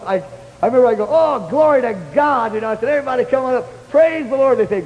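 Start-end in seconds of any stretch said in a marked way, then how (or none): 1.1-2.5 higher-pitched, drawn-out cry of praise; acting out overjoyed praise; happy exclamation. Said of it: "I was so happy". 2.9-4.6 high-pitched call to praise; re-enacted joyful summons, pitched high; happy rallying call. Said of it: "I was so happy".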